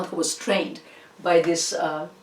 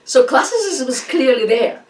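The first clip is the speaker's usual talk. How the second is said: laughing; 5 semitones higher, 6 dB louder than usual. Real, not acted. Laughing, trying to resume.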